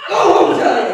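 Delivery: loud, hammed-up action-cop outburst. Shown as a demonstration. Demonstrating a loud-noise trigger to the audience, mock action cop. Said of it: "start acting like an action cop".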